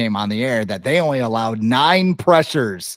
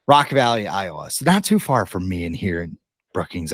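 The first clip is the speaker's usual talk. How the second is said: usual speaking voice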